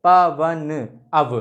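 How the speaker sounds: पवन said very slowly, stretching the अव sound; drawn-out pronunciation. Shown as a demonstration. Teacher showing students how पवन sounds, very slow and stretched. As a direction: draw out पवन so the अव is heard. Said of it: "पवन जब बोलोगे तो अब निकलेगा".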